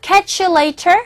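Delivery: British "catch you later", crisp t; a hint of British English; British accent. Shown as a demonstration. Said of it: "영국식 발음은 later".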